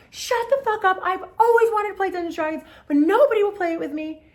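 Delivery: very high, loud excited squeal; imitating a friend's high, ecstatic outburst; thrilled outburst. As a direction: shriek it like an overjoyed friend. Friend thrilled about Dungeons and Dragons.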